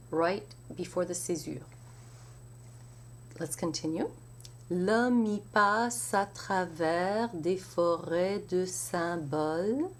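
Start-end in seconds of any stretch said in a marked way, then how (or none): 4.7-9.9 L'homme y passe à flowing together without breaks; linked recitation. Shown as a demonstration. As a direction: chain the words slowly. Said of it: "some enchaînements".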